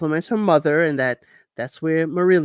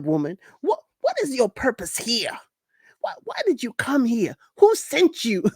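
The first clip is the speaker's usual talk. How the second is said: exaggerated dramatic man; 6 semitones higher than usual. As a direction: ham up the suspicious man. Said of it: "that was all me exaggerating".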